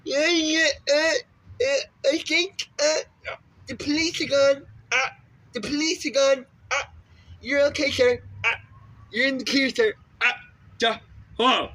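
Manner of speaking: very high, hesitant little voice; squeaky engineer voice. Acted. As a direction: stammer nervously as a tiny helper. Voicing engineer character replying.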